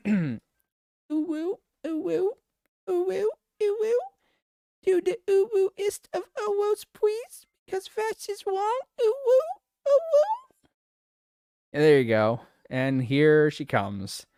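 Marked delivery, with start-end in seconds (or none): 1.1-4.0 very high, tiny cutesy uwu squeak. Pretend. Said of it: "I gotta find the voice". 4.8-8.8 very high cutesy uwu falsetto. Pretend. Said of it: "I gotta find the voice". 9.0-10.5 extremely high squeaky uwu falsetto. Pretend. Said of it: "I gotta find the voice".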